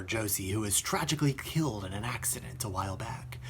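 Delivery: whispered, dropping low and soft; solemn whisper; hushed whisper. Acted. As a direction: lean in and whisper the tragedy. Tragic detail in film review.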